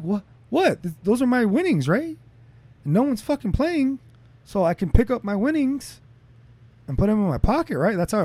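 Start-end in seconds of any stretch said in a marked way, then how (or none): 0.0-2.2 baffled protest. Retold or imitated. Confused protest at casino table.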